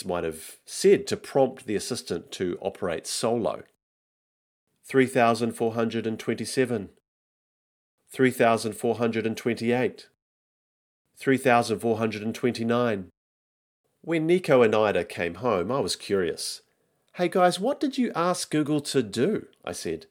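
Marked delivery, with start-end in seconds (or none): 4.9-13.1 even, mechanical counting; virtual assistant. Acted. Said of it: "hear the Google Assistant mumbling something". 17.1-19.5 put-on high, singsong question to the kids; higher-pitched, innocent-sounding inquiry; curious parent.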